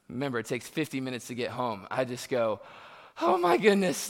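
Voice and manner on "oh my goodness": a sudden high yelp of alarm on "Oh my goodness"; panicked gasp. Retold or imitated. Teen realising he'll miss curfew, panicked high cry. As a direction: shoot the pitch way up in shock.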